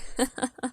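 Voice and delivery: short villainous chuckle; gloating laugh. Acted. First wife celebrating her rival's lost hair, gloating laugh. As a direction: laugh to yourself in triumph.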